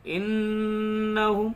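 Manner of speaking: इन्नहुम with a long nasal hum on the doubled न; nasalised ghunna on इन्नहुम. Shown as a demonstration. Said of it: "तो गुन्ना करते हैं".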